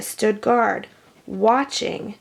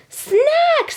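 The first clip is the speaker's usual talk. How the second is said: whiny insisting; 3 dB louder than usual. A narrator's reading. B B insists again.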